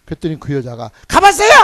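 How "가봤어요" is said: loud, high, annoyed retort; irritated snap. Retold or imitated. Woman snapping at the preacher, loud and irritated. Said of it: "신경질로 자꾸 나한테 그랬지".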